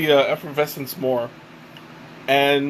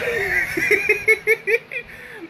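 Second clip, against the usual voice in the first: squealing high laugh; high-pitched laugh. Real, not acted. Laugh at own joke.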